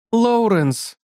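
high call across the field. Acted. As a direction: call his name over the crowd. Stella calls out to Hall.

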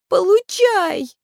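high, drawn-out shout; tearful cry. A narrator's reading. Maeve hurling a stone at the keener, tearful shout. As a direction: cry it out high, stretching the end. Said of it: "со слезами выкрикнула Мэв".

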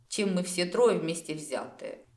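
plain reading voice

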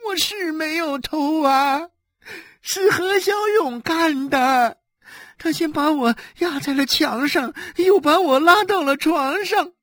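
whining, sobbed explanation; tearful pleading. Acted. Wife defends herself to her husband, crying. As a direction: plead your innocence through tears.

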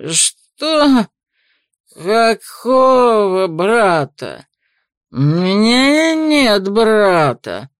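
put-on slow slurred drunk protest; drunken outburst. Drunk man confused outburst.

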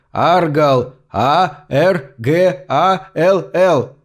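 Аргал shouted, then spelled out very slowly letter by letter; desperate shout, spelling Аргал letter by letter. Acted. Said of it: "закричал он в мёртвое ухо".

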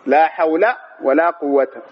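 "حول" and "قوة" both ending in bare -a; slow "حول" and "قوة", fatha without tanwin. Shown as a demonstration. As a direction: say hawla for "حول", quwwata for "قوة", slowly. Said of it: "نعمل لا هنا وهنا".

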